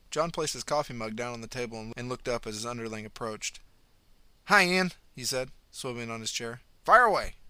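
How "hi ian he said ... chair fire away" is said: booming, louder boss voice; loud boss greeting. A narrator's reading. Stressed boss John greeting his underling loudly. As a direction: call out gruffly from the desk.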